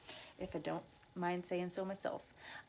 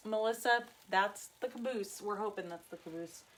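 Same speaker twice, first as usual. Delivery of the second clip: ordinary talk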